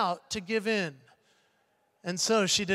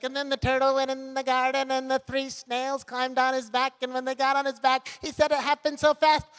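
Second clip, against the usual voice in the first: lightly voiced chatty driver rambling a joke; driver telling jokes; 3 semitones higher than usual. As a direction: ramble through a silly joke, slightly higher. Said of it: "telling herself jokes".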